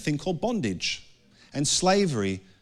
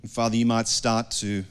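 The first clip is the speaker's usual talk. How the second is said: closing prayer; 4 semitones lower than usual. Acted. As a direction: pray gently over the congregation.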